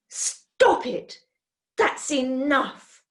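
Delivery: sharp, slow angry rebuke; snapping with temper. Acted. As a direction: cut the boys off in anger. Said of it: "snapped with temper".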